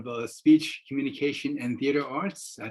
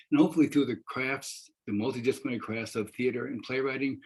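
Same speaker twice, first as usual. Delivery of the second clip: ordinary talk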